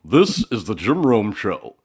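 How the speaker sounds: slow, booming radio announcer read; grand radio show intro; big announcer voice. Retold or imitated. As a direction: boom it out like a station open. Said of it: "went right back to the".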